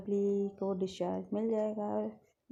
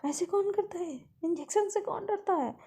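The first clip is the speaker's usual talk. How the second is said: exasperated shout; 9 semitones higher than usual. Acted. Nurse storming out after the girl fought the injection, shouting. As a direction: burst out, fed up and frazzled. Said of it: "shout करते हुए बाहर आती है".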